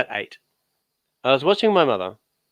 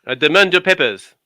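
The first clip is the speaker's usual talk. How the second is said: loud, higher, hammed-up French official; mock border guard; 5 semitones higher, 7 dB louder than usual. Mocking his cohost, a loud pompous French border guard.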